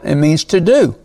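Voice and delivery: normal talking voice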